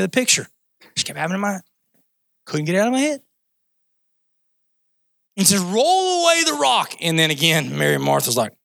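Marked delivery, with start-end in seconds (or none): 5.6-6.9 high, drawn-out commanding call; Jesus. Acted. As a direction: call out the order slowly and grandly. Acts out Jesus's command again.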